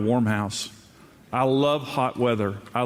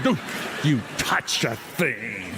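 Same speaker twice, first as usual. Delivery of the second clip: slow deadpan; 2 semitones lower, much slower than usual. Retold or imitated. His reply to wife.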